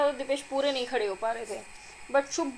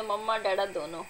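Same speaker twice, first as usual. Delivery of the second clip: ordinary delivery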